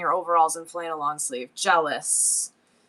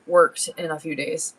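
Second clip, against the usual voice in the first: usual speaking voice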